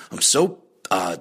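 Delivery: ordinary delivery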